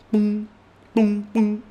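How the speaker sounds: mime sound effects. Acted.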